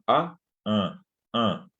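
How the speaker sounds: "A" held nasal with the nose pinched shut; closed, intense nasal "A"; nasal vowel, nose pinched. Shown as a demonstration. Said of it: "pueden taparse la nariz".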